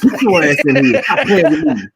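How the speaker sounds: loud, laughing mock scold at the cat.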